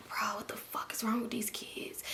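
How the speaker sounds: hushed, fed-up whisper; exasperated whisper. Retold or imitated. Aunt annoyed with the kids, whispering to herself. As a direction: whisper it under your breath in disbelief.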